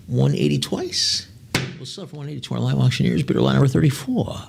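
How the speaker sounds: measured auction closing call; auctioneer bid call. Acted. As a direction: close the lot like an auctioneer.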